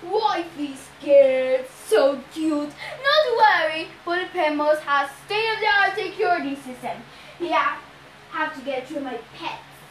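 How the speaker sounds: loud monster voice, easing off at the end; boastful Cyclops. A narrator's reading. Cyclops reassures his bride about security, boastful. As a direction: brush fears aside as a smug monster.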